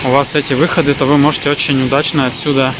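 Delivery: ordinary talk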